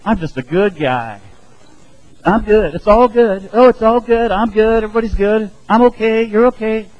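high, loud, breezy boast; smug self-assurance. Retold or imitated. Sinner telling God he's fine, loud and smug. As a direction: brush it off like a cocky good guy. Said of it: "go up to God and say".

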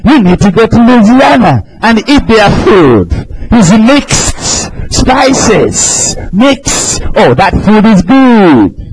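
ordinary talk, nothing marked

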